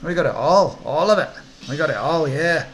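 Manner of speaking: loud, swooping Scottish hawker; Scottish accent. Acted. Twitchy orc dealer selling chip trips, sing-song Scottish accent. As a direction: sell it hard with a Scottish lilt. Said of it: "Don't know why he's Scottish".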